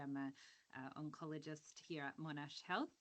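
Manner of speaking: very soft, far from the mic; faint, hard to hear; quiet voice. Real, not acted. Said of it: "You're really quiet".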